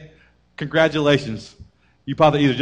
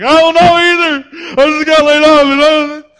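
high loud despairing cry; panicked husband; 11 semitones higher, 9 dB louder than usual. Pretend. Husband despairing over layoff, loud high cry. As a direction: lose it completely, voice cracking high.

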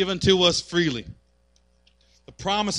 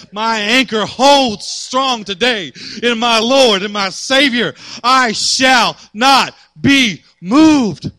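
louder, higher preaching, slowing to shouted lines; preaching at full force; 4 semitones higher, 7 dB louder, slower than usual. Acted. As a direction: build the crowd up to a roar.

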